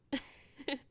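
short breathy laugh; spent giggle. Acted. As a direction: giggle as the breath comes back. Laughs while recovering.

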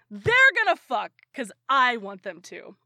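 loud mock-fan outburst; loud, mocking quote; mocking shippers. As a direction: imitate an entitled fan, loudly.

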